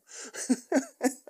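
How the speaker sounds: high, loud burst of laughter; real laugh. Real, not acted. Vlogger amused by his own rubbish accent, high loud laugh. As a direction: crack up laughing at yourself.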